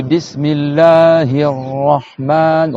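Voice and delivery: Bismillahir Rahman, slow, long held R and vowels; held doubled letters. Shown as a demonstration. Teacher reciting for students, Bismillahir Rahman slow and held. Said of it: "On redit la shadda".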